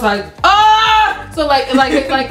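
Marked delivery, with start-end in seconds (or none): 0.4-1.3 high, drawn-out imitated scream; high acted "ah" of excitement; mock freak-out scream. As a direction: re-enact your fangirl squeal. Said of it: "I was freaking out".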